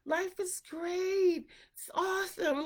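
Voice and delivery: high, slow dreamy hippie; imitating a happy hippie. Hippies who just love life, high and slow. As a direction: drift through it like a blissed-out hippie. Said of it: "They just love life".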